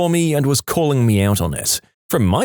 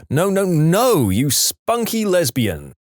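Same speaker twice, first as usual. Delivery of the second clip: slow, higher-pitched mock yell; 6 semitones higher, slower than usual. A narrator's reading. Girlfriend protesting in her sleep, mock yelling. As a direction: protest slowly with stretched words. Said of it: "yelled in her sleep".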